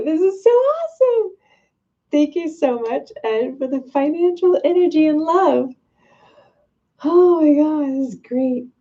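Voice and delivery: high-pitched, laughing gush of thanks; giddy and thrilled, voice way up high; real delighted excitement. Real, not acted. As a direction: squeal with overjoyed gratitude. Delighted thanks for a listener's donation.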